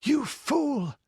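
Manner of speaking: very high, loud furious cry; frantic, high-pitched outrage; hysterical scream. A narrator's reading. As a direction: scream it, almost beside yourself. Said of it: "screamed Balessa".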